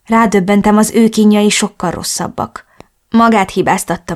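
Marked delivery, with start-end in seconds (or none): none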